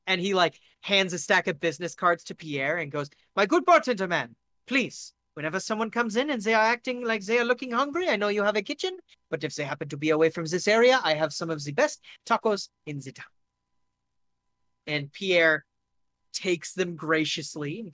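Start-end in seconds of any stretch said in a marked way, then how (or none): 3.4-13.3 put-on higher, eager pitch in a German-French accent; keen salesman with a German-French accent. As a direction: sell it politely in a Franco-German accent. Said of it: "he's switching between German and French".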